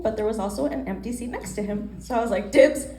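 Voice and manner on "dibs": loud dibs. Retold or imitated. Claiming the seat next to him.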